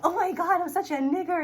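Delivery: high, gushing fake excitement; mock eagerness. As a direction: gush loudly, mocking an eager pleaser.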